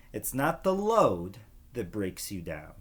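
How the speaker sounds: ordinary delivery, nothing marked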